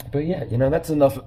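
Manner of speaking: hoarse sore-throat voice; talking through a sore throat. Real, not acted. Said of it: "Sore throat".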